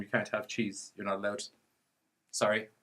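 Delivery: mock-polite, softly spoken to the dogs; polite refusal. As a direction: gently let the puppies down. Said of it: "politely explain to them".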